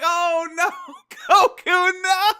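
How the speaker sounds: high, loud no through laughter; laughing dismay. Retold or imitated. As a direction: groan at Goku while cracking up. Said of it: "And I'm like".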